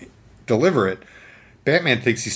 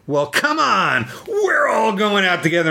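defiant Batman; 10 semitones higher than usual. Retold or imitated. Batman challenging the rogues before the blast, high defiant cry. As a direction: dare them all to come at you. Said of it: "And he says".

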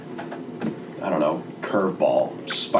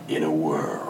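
deep, slow trailer narration; gravelly epic announcer; movie trailer voice; 7 semitones lower, 6 dB quieter than usual. Acted. Trailer voice again.

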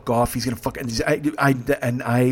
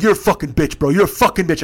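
raised, loud passenger imitation; retold angry insult; 5 semitones higher, 6 dB louder than usual. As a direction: hurl the insult as the drunk passenger.

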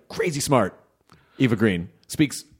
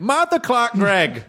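slow, grand name announcement, higher; announcer voice; 8 semitones higher, 3 dB louder, slower than usual. Acted. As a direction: ring out the name. Mock grand full name.

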